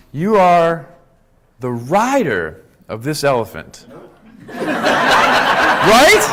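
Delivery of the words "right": a laughing, breathy right. Real, not acted. Host laughing to the audience about the rider, breathy laugh. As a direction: laugh the question out.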